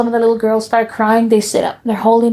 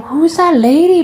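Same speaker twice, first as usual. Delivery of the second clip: put-on child's high question; 9 semitones higher, 5 dB louder than usual. Child asking mom.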